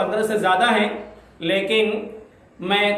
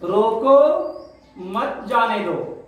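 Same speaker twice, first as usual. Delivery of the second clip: long break after "روکو", then slower "مت جانے دو"; pause after روکو; slower than usual. Shown as a demonstration. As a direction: halt on "روکو", then run "مت جانے دو" together. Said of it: "روکو کے بعد سکتا لگایا".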